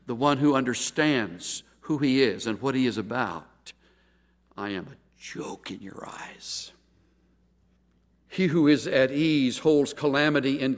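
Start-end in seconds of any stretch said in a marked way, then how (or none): 4.6-6.7 whisper. Acted. Job's lament, quiet climax.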